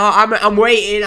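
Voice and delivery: ordinary talk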